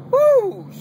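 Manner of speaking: high, loud incredulous "woo"; a sharp high whoop of disbelief; disbelieving whoop. Real, not acted. Fan reacting to a baffling roster move: high, loud whoop.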